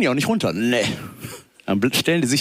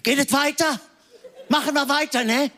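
put-on high, thin old lady voice; normal granny; 9 semitones higher than usual. Granny in the announcer's booth speaking normally, high-pitched. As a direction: talk like a polite granny into the mic. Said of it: "Eigentlich ist die so".

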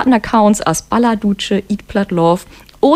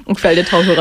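ordinary talk, nothing marked

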